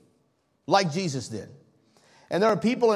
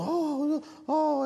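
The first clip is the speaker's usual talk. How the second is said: high-pitched mock swooning; fawning fans; 8 semitones higher than usual. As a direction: imitate star-struck fans gushing over an idol. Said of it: "celebrity preachers that people just".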